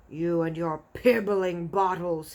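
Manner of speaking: slow, loud sneering giant; scornful giant shout. A narrator's reading. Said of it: "he shouted".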